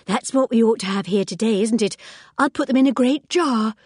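eerie cheery announcement; odd Anthea. A narrator's reading. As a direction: announce the lilies a little too brightly. Anthea talks of funeral flowers.

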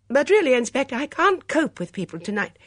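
high, loud, overwrought protest; end of her tether. A narrator's reading. Woman protesting to the inspector, at the end of her tether. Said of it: "sounded as though she were at the end of her tether".

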